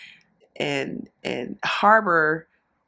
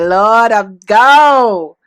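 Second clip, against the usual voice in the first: drawn-out, much louder mock plea to the Lord; dramatic surrender; 10 dB louder, much slower than usual. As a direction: ham up giving your soul to God. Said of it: "I'd be like".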